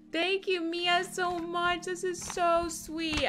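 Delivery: high, slow, touched thanks; holding back tears. Real, not acted. Streamer thanking a fan for a gift, holding back tears. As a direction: gush tenderly, close to crying. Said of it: "I'm trying really hard not to cry".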